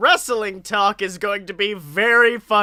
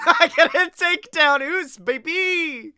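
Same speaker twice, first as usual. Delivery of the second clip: hype boast; 8 semitones higher than usual. Acted.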